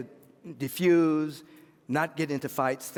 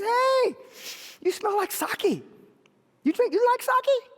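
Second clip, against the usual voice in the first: very high, loud little-man voice; shrill small man; 4 dB louder than usual. Acted. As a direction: pipe up shrilly to the belligerent guy. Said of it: "this shrill voice that says".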